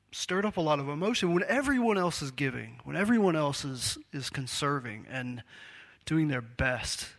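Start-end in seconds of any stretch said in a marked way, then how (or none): none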